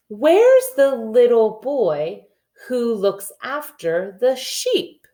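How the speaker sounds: slow, sing-song rhyme reading; nursery rhyme reading. Acted. Teacher reading Little Boy Blue to the class. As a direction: read it out slow and rhythmic for little kids.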